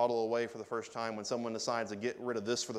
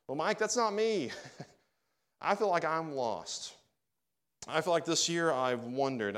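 imagined listener; 5 semitones higher than usual. Retold or imitated. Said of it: "imagined listener objecting".

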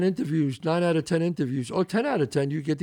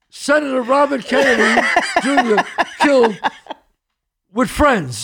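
louder, laughing mock headline; mock news headline; 6 semitones higher, 5 dB louder than usual. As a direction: announce it like breaking news, laughing. Mock headline of plane crash.

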